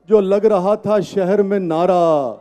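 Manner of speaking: measured, emphatic verse recitation; poetry recital. Acted. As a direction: recite it to a live audience. Recites ghazal at mushaira.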